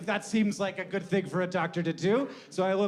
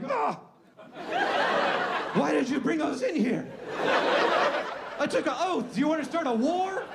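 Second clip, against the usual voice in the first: high-pitched mock horror; hammed-up scandalized outburst; appalled doctor; 8 semitones higher than usual. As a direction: recoil in panicked outrage.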